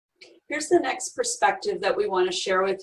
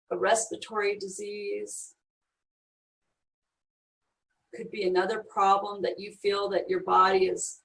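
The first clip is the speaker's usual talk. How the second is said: guided meditation; 3 semitones lower than usual. Acted. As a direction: lead them gently inward. Leads a guided breathing meditation.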